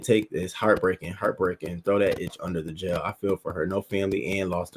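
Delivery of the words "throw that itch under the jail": normal talking voice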